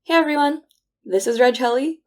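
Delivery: ordinary delivery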